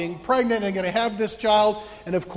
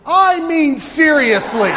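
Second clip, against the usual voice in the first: high, loud and laughing; excited outburst; 8 semitones higher, 11 dB louder than usual. Real, not acted. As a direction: burst out over the ringing bell. Excited over the church bell.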